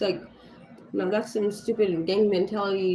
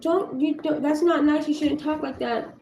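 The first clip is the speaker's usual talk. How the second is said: put-on little girl scolding; 6 semitones higher than usual. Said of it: "another little girl was like".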